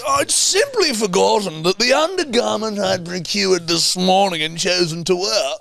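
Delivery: high, drawn-out mock British aristocrat; British aristocratic accent. Sending up Louisa's cashmere remark, British aristocratic accent. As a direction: ham it up as a lofty British noble. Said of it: "very distinct British aristocratic accent".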